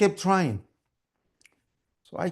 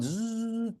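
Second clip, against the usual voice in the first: high motor hum; sewing machine buzz; 8 semitones higher, 6 dB quieter than usual. Acted. Mom kept on sewing, high buzzing imitation. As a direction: imitate a machine that won't stop. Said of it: "she just kept on going".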